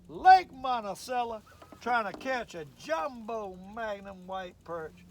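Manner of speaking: slow, loud show-intro announcement; slow loud mock announcer read. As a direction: announce the show title slowly.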